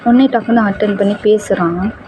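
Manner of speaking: plain reading voice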